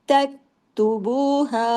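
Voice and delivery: तक्तुबूहा drawled slowly with a wrong pause after तक; mock wrong reading. Shown as a demonstration. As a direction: imitate a student stretching तक्तुबूहा. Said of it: "आप कहेंगे, "मैं सही पढ़ी". (गलत तिलावत की नकल)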